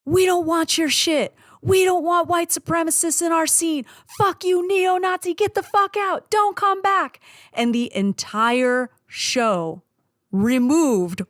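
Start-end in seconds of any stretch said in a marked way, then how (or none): 0.1-7.2 crowd yelling. Retold or imitated. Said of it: "they all start to join him".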